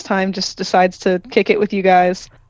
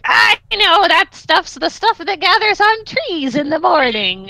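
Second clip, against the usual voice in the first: put-on old granny voice; 8 semitones higher, slower than usual. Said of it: "Granny's just like".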